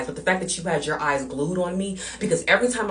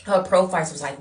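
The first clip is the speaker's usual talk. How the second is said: ordinary delivery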